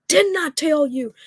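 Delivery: high-pitched imitation of a yelling partner; mock angry yell. Upset guy yells at his partner, mock angry yell. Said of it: "he yells at you".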